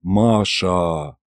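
low, lingering, savoured; drawn out. A narrator's reading. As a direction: stretch the name out in admiration. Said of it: "протянул Шарль".

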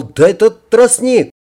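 acted delight, starting high and strong; pleased exclamation. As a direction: exclaim over a welcome find. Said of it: "довольно воскликнул".

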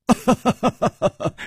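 high staccato acted chuckle; wry laugh. A narrator's reading. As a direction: laugh ruefully in a falling ha-ha run. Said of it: "楚留香苦笑着说". (一串苦笑)

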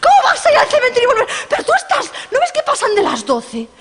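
very high, fast, laughing shouted panic; hysterical. Acted. Friends beg the hostess not to go, high hysterical shout. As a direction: shriek and half-laugh in panic. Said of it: "Se pusieron histéricas".

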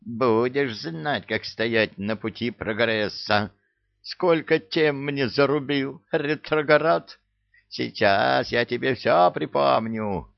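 high, slow gloating imp; gleeful imp voice. Acted. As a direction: gloat like a spiteful little devil. Imp gloats while beating statue.

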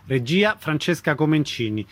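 ordinary talk, nothing marked

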